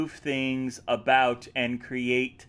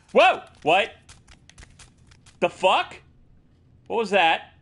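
startled; 6 semitones higher, 5 dB louder than usual. Real, not acted. Startled by sound in game.

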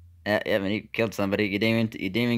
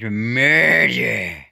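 dramatic announcer; 3 semitones higher, 7 dB louder than usual. Acted. Game title announced dramatically.

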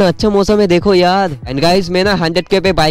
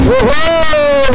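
very slow, high stretched ohoo; drawn-out catchphrase; 13 semitones higher, much slower than usual. Shown as a demonstration. Vlogger opening his famous line to viewers, long high ohoo. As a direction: stretch it out like a signature exclamation. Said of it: "मेरा ये dialogue ना भाई बहुत ज़्यादा famous हुआ था".

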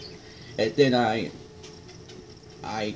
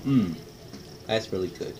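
talking between bites; chewing while talking. Real, not acted. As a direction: mouth partly full. Seen 1: Mouth closed and working after bites, tender held at lips.

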